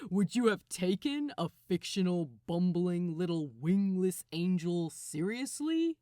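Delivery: a smug jab at Clarence; Gabriel, mocking. Gabriel teasing Crowley about a fictional angel.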